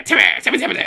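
high, loud squeaky Timmy shout; cartoon kid yelling his own name; Timmy impression. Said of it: "he only says one word".

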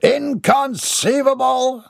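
high, drawn-out outrage; indignant. A narrator's reading. Mortimer hears there is no list, indignant. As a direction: stretch it out in pompous disbelief. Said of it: "his indignant face".

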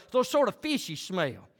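ordinary talk, nothing marked

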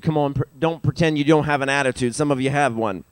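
usual speaking voice

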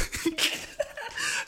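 airy squeal of laughter; giggling burst; high breathy laugh. Real, not acted. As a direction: laugh at your own punchline. Laughs at own joke.

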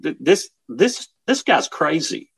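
high, halting, incredulous inner voice; stunned disbelief. Retold or imitated. Voices the shocked major's thoughts.

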